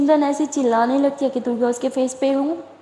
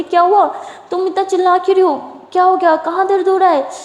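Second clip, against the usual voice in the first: louder, much higher, fast worried questions; alarmed; 7 semitones higher, 4 dB louder than usual. A narrator's reading. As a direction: rush to ask what hurts. Alarmed at her cries.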